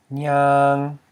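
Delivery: nyang said on a high level tone. Shown as a demonstration. Teacher models the tone of the word, nyang said high and level. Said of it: "this word is pronounced as".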